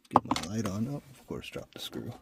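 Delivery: ordinary talk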